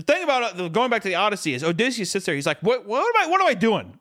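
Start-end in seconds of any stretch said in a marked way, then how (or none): 2.6-4.0 high, startled hero coming to his senses; hammed-up sudden realisation; mock alarm. Odysseus snapping out of it.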